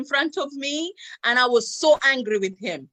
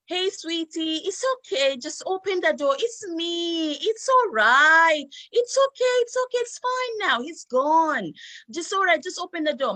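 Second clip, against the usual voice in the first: put-on high, sweet coaxing voice to a hiding child; coaxing grandmother; 5 semitones higher than usual. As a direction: lure her out with a sugary high pleading. Grandmother coaxing sister from toilet.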